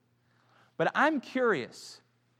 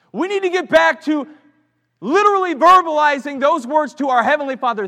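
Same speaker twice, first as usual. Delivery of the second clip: preaching louder and higher, building to joy; rising sermon passion; 9 semitones higher, 12 dB louder than usual. Acted. Preacher to the church, building excitement. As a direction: swell the congregation up with wonder.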